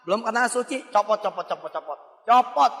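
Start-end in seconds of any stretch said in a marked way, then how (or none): 1.5-2.0 copot, copot dropped low and soft; softer coaxing copot, copot; quiet. Shown as a demonstration. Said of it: "urging to take the ring off". 2.3-2.7 Copot said louder and higher; loud emphatic Copot; firm command. Acted. Preacher ordering ring removed.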